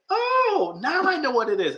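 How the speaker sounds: high-pitched acted eureka; imitating a gullible person's revelation; mock sudden realization. Voicing someone suddenly convinced by lie.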